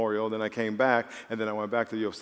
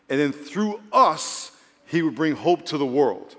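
loud, higher, emphatic sermon delivery; forceful preaching; 5 semitones higher, 8 dB louder, slower than usual. Real, not acted. Pastor telling the church they are the plan, forceful preaching. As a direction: drive each phrase home, slowing on key words.

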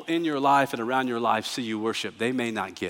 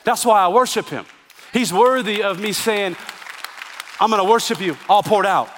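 louder and higher preaching build; full-force preaching; 4 semitones higher, 3 dB louder than usual. Acted. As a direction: preach it with fire. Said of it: "preaching at full force".

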